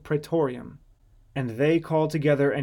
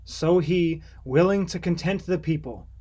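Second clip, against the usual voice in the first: plain reading voice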